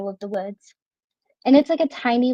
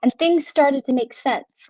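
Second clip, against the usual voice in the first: normal talking voice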